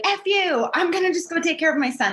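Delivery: imagined table-flipping telling-off; imagined defiant outburst. Retold or imitated. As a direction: fling it out defiantly. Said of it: "and be like".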